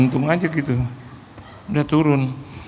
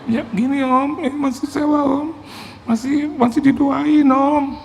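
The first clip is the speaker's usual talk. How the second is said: high, stretched mock-weeping plea; imitated tearful whine; 12 semitones higher, slower than usual. Pastor says rent still being prayed for, mock tearful whine. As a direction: whine it slowly, as if close to tears. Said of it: "kenapa dia harus nangis gitu loh".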